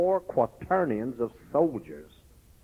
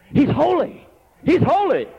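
high, loud cry of holy; 13 semitones higher, 10 dB louder than usual. Acted. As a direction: cry it out to one another. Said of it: "They were crying one to another".

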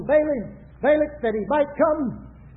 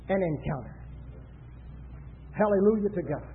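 low, soft sermon delivery with long pauses; hushed preaching; 4 semitones lower, 6 dB quieter than usual. Acted. Preacher warns congregation of the devil's encounter, hushed low preaching. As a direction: drop down low and let each phrase hang.

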